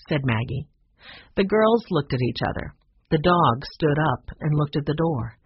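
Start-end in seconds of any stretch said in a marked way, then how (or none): none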